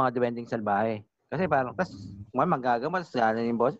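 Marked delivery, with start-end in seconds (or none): none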